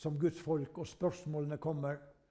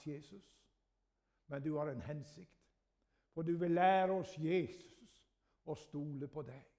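prayer. Real, not acted. Closing prayer.

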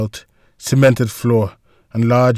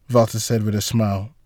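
ordinary delivery, nothing marked

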